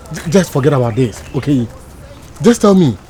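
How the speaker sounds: ordinary talk, nothing marked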